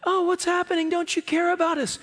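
mock panicked disciples, very high-pitched; high, frantic imitation of terrified men; freaking out. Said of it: "they're freaking out before".

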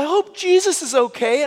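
high, loud mock-tearful little girl; crying child. Little girl worried about Jesus after her fall, mock crying. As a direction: wail it like a hurt child. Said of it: "she's crying. She's like".

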